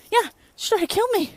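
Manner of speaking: high, loud playful accusation; mock outrage. Vlogger joking coworker tried to kill her, mock outrage. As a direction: throw up your voice in fake horror.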